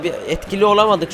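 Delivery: ordinary talk, nothing marked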